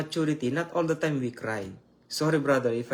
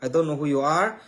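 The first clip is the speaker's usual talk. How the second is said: ordinary talk, nothing marked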